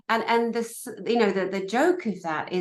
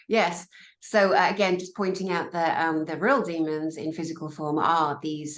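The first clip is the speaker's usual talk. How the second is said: usual speaking voice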